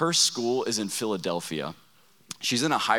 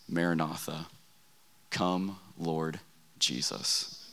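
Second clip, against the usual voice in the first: soft, low, fading almost to a whisper; hushed grandfather; 5 semitones lower, 6 dB quieter, slower than usual. Retold or imitated. Grandfather hushes the key prayer to his grandsons, softly. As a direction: let the words sink to a reverent hush.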